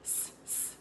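"S, s" hissed as a voiceless sss; isolated first sound. Shown as a demonstration. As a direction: say "S, s" as a long unvoiced hiss. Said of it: "say the first sound of the word".

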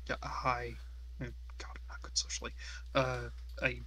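softly, awkward and nervous; nervous mumble, barely voiced; shy and quiet. Shy character greets the smith.

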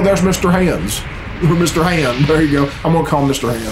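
ordinary talk, nothing marked

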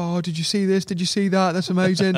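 mock giddy excitement, high; excited. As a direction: gush like you just landed the fish. Buzzing chatter after landing fish.

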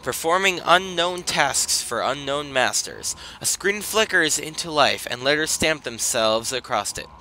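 reading game text with gravitas; storyteller reading aloud; narration read. Acted.

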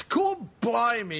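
mock Cockney. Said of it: "all I wanted him to say was".

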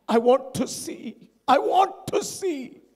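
slow, drawn-out plea climbing high; acted plea. Blind man pleads with Jesus.

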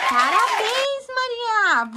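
excited praise. Real, not acted. Praising the toddler's correct colour.

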